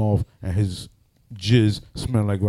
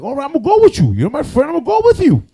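eager offer; 6 dB louder than usual. Retold or imitated. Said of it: "he's like".

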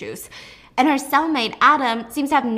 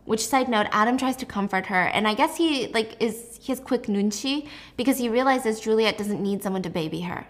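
ordinary talk

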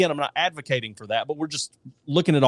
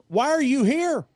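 joke voice of God; 10 semitones higher than usual. Acted. The Lord in joke.

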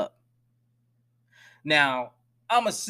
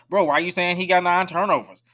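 mock defensive fan; 5 semitones higher, 6 dB louder than usual. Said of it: "fans be like".

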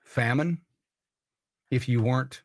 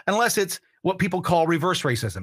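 ordinary talk, nothing marked

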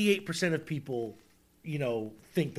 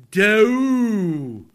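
mock howl; 7 dB louder than usual. Howling delivery.

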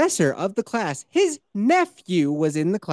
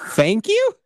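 puzzled thanks; 5 dB louder than usual. Retold or imitated. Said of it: "I was like".

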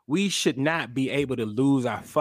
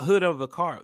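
ordinary delivery

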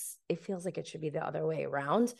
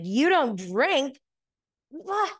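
drawn-out, higher-pitched incredulity; mock disbelief; 7 semitones higher, 4 dB louder, much slower than usual. Retelling a man mocking her for not drinking, mock disbelief. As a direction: make fun with a high, stretched question. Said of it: "making fun of me for not drinking".

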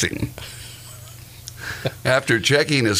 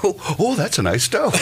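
mock impressed tenant; 10 semitones higher than usual. Acted tenant touring the house.

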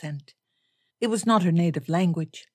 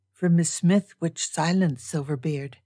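usual speaking voice

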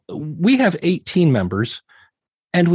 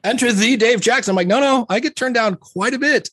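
mock grand fanfare; 10 semitones higher than usual. Mock grand announcement of Dave.

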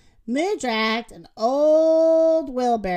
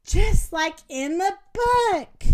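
higher, drawn-out satisfied remark; proud; 6 semitones higher, slower than usual. A narrator's reading. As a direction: say it slowly with raised, proud pitch. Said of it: "she said proudly".

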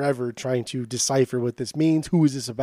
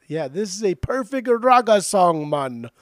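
hammed-up Jamaican accent, higher and loud, words drawn out; bad Jamaican accent; 4 semitones higher, 5 dB louder, slower than usual. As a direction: put on a terrible Jamaican accent and gush. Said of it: "Oh, the worst accent".

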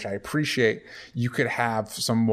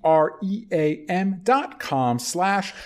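slow spelled-out URL; 4 semitones higher, much slower than usual. Shown as a demonstration. Cohost spells the sponsor URL slowly for listeners. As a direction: spell each letter slowly. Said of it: "spelling out ad URL".

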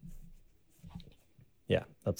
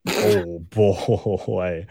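laughing dismay; 3 semitones lower than usual. Real, not acted. Host hears his guess was way off, laughing dismay. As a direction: laugh through the words in disbelief.

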